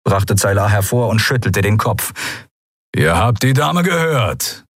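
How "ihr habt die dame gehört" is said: slow, raised growl; drawn-out gruff menace. A narrator's reading. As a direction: back up the order with a growl. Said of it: "knurrte Keru".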